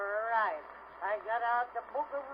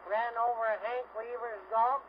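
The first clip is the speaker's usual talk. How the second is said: ordinary talk, nothing marked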